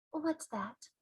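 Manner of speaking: put-on a puzzled small girl; Sophie's voice. Sophie puzzled by the giant, softly.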